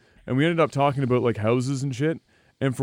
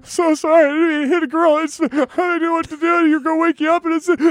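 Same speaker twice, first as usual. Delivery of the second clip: mock crying apology; 13 semitones higher, 3 dB louder than usual. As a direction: wail out the sorry like a scared boy. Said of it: "I'm like, crying".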